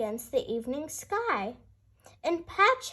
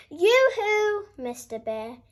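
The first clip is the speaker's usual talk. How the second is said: higher and louder yoo-hoo that drops on the name; calling out; 5 semitones higher, 5 dB louder than usual. A narrator's reading. Hare calling to the bear while scooting over, cheery call. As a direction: sing out a cheery greeting from afar. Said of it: "he called".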